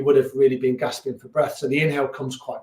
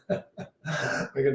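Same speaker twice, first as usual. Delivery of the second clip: laughing; 6 dB quieter, much slower than usual. Real, not acted. Jokes about ten minutes.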